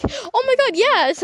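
high, gushing mock fan reply; excited. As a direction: squeal it like an eager fan being imitated. Acting out a fan's eager reply.

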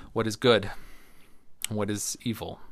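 ordinary talk, nothing marked

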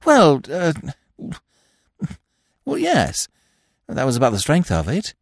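high, breezy upper-class twit; chatty, airy young gentleman; silly young toff. A narrator's reading. Freddy caught out.

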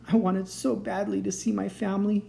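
a touch of tearful sniffle; sniffling. Acted. Stuck baby dragon missing his family, mildly sniffling. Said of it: "he sniffled".

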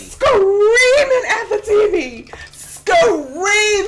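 "Screaming" stretched slow and high; drawn-out screaming. Retold or imitated. Viewer insisting on screaming at the TV, "Screaming" stretched high.

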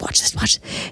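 hushed whisper, letting friends in on it; mimicked whisper. Friend whispers to Sarah before hitting on a guy, hushed.

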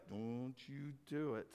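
a hint of a warning mother, hushed; low hushed threat from a mom to her son; lightly voiced mom scolding under her breath; stern mom. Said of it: "that mom's look at their young boy that was like".

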